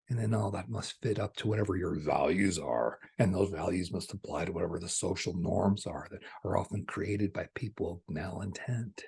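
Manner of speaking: ordinary talk, nothing marked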